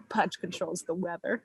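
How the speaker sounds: put-on hushed matter-of-fact little girl; quiet deadpan Lilo line; quoting Lilo. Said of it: "That was a perfect quote".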